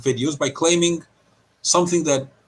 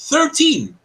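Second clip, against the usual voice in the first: indignant exclamation; 13 semitones higher than usual. Real, not acted. Outraged at leaked password count.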